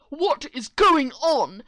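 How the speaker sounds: loud slow yell; dad yelling. Acted. Dad storming in, yelling. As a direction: bellow it as you walk in. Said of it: "my dad walks in yelling".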